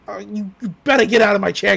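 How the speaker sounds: a light gruff Grunkle Stan, a bit fast; lightly voiced cranky old man, a bit fast; Grunkle Stan impression. Stan ordering Gideon out of his chair, lightly gruff. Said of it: "I can do it ... best impression".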